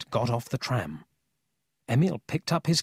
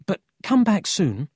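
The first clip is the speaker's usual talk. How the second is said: higher, pressing request; lightly voiced anxious boy; 9 semitones higher, 4 dB louder than usual. A narrator's reading. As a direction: urge him to return. Emil urges Paul to hurry back.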